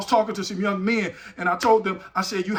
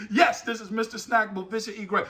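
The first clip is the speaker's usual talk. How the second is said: ordinary delivery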